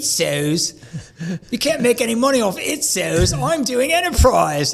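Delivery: put-on South African accent. Imitating Gerald scoffing at MSPs in a mock South African accent. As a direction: imitate a South African businessman brushing off small clients. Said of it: "he's got that Australian or South African accent".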